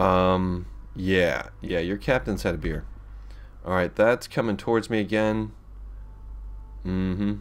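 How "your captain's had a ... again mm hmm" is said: normal talking voice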